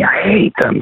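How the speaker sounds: shrill-pitched child's cry, imitated without volume; retold scream. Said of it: "she just screams at me".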